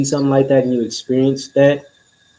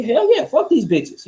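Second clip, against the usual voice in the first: much higher, gleeful agreement; excited cheer; 10 semitones higher than usual. Retold or imitated. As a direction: hype it up, fully on board. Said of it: "she right along".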